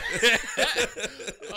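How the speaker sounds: loud high-pitched burst of laughter. Real, not acted. Big laugh.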